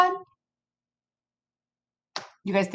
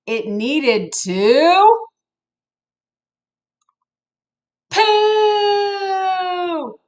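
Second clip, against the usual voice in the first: slow build, then poo yelled much higher; comic build to a shout; 9 semitones higher, much slower than usual. Pretend. Reader hitting the story's joke for laughing kids, slow build to a high shout. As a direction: hold back, then burst out poo.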